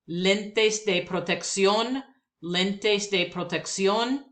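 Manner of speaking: lentes de protección said slowly, stress on CIÓN; Spanish phrase. Shown as a demonstration. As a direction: lentes de protección, rising at the end. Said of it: "words that I will pronounce".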